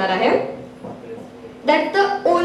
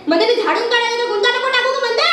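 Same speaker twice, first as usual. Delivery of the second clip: higher, fast, exasperated outburst; escalating nag; 6 semitones higher, faster than usual. Acted. Woman sweeping, fed up with tangles, shrieky nagging. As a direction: speed up and climb in pitch to the last word.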